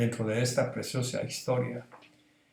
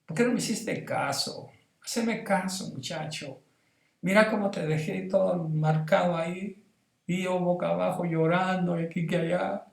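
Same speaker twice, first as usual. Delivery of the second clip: madre llorando arrepentida; 5 semitones higher, 3 dB quieter than usual. Retold or imitated. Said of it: "Y mi mamá".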